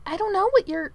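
high, hurried-sounding protest, breaking off; alarmed denial. A narrator's reading. Manservant fearing his secret is known, alarmed. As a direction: deny it as if caught out. Merlin denies, cut off.

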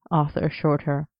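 usual speaking voice

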